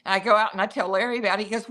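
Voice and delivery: normal talking voice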